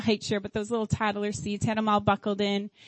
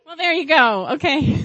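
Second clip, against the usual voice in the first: loud, higher, breaking into a laugh; tickled, louder reply with laughter; amused; 4 semitones higher, 8 dB louder than usual. Real, not acted. Speaker reacting to an audience answer, amused and laughing.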